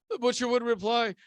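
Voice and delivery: usual speaking voice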